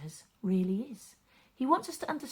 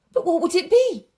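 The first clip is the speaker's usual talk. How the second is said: higher, louder worried question; anxious wondering; 7 semitones higher, 13 dB louder than usual. Acted. Father hurrying home, anxious about his son, worried. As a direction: let the worry rise. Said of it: "he thought".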